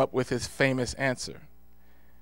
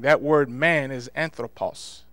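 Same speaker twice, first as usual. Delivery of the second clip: usual speaking voice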